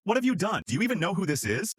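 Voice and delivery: high-pitched scolding, only mildly shouted; restrained shout. A narrator's reading. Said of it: "the manager shouts".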